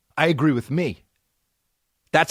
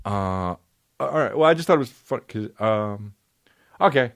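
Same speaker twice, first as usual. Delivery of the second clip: deflated director. Retold or imitated. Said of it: "the director goes like this".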